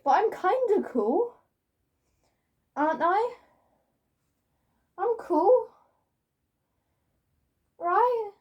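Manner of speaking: high-pitched, rising, seeking reassurance; unsure plea. Real, not acted. Vlogger asking viewers if they're cool, unsure and high. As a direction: squeak it upward like a question. Backtracks, begging agreement on being cool.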